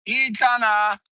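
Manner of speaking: high call across the yard to the dog. Real, not acted. Vlogger calling her dog Etana across the ranch, high call.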